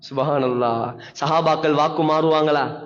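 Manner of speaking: ordinary talk, nothing marked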